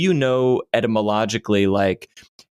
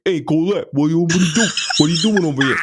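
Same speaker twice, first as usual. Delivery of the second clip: put-on brash New York Long Island accent, a bit louder; 6 semitones higher, 3 dB louder, faster than usual. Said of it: "lizard part of my brain is like".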